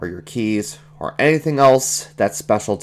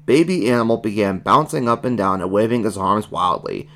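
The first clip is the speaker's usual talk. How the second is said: ordinary delivery, nothing marked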